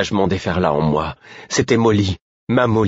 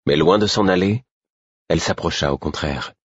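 ordinary delivery

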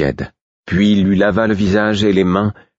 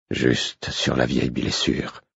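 softer, slow and faint; weak voice; 5 semitones lower, 6 dB quieter, slower than usual. A narrator's reading. Wounded man weakly tells them where it hit, soft and slow. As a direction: let the words barely come out, slowly. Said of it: "fit-il d'une voix faible".

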